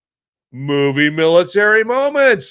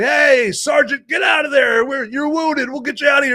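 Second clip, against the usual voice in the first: yelling; 11 semitones higher than usual. Pretend. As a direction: shout to him across the gunfire. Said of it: "people yelling at you".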